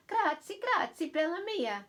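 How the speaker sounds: high-pitched Italian Nana giving thanks; grateful, lilting Italian accent. Acted. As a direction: thank the child as an Italian grandmother. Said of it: "will have an Italian accent".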